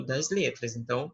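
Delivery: ordinary talk, nothing marked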